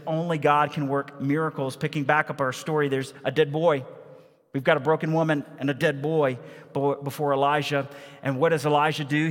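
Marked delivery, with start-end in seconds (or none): none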